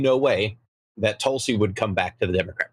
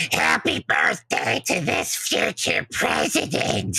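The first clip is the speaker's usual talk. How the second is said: slow, high croaky Hillary voice; Hillary impression; 6 semitones higher, slower than usual. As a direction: do a witchy Hillary. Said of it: "my proper Hillary impression".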